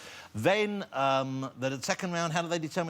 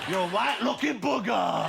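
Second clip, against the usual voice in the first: game show host; 5 semitones higher than usual. Pretend. Host announcing.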